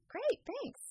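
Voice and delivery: high, startled thanks; surprised. Retold or imitated. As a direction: caught off guard by a stranger. Startled reply.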